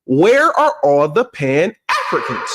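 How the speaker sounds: slow, stretched question with inflected Africans; pointed inflection. Shown as a demonstration. Vlogger repeating the challenge to Pan-Africans, pointed inflection. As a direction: draw out "Where are all", then lean on Africans. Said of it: "heard the inflection in my voice".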